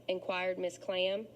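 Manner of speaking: usual speaking voice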